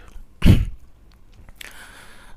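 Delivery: harsh throat clear. Real, not acted. Clears throat mid-video.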